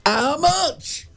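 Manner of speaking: high, outraged disbelief at the price; a wailing cry of price shock. Real, not acted. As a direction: squeal it out in horror at the cost.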